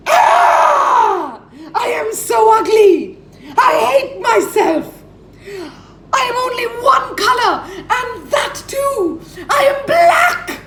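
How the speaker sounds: high, loud, hysterical shriek of self-disgust; a frantic bird screaming at its own reflection. Acted. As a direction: wail it out in high-pitched despair, half laughing, half crying. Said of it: "and shrieked".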